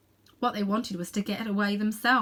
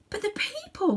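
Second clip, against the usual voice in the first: gasped; 13 semitones higher than usual. A narrator's reading. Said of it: "gasped Diggory".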